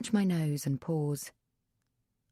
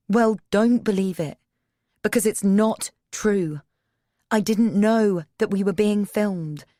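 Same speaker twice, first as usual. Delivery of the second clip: higher and louder, defending herself; raised voice; 4 semitones higher, 5 dB louder than usual. A narrator's reading. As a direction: push back hard over his shouting. Cass shouting back in argument.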